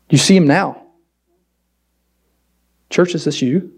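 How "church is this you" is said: ordinary talk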